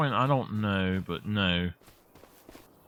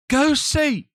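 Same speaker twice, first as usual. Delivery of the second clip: bossy wife; 12 semitones higher, 4 dB louder than usual. Retold or imitated. Wife ordering him out.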